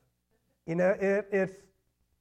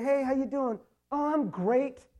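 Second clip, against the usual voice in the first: higher-pitched imitation of a breezy greeting; mock cheerful; 7 semitones higher, 4 dB louder than usual. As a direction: act out an upbeat church hello. Acting out false cheerful greeting exchange.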